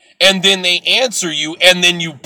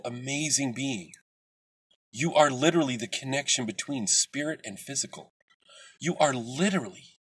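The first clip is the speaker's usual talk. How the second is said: hushed, lower, loving encouragement; soft affirmation; 3 semitones lower, 9 dB quieter than usual. Real, not acted. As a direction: speak to them gently, like a healer.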